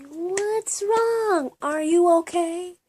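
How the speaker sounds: imitating people nagging with concern; concerned classmates. Imitating schoolmates asking if she's okay, high and mock-concerned. People would always ask me.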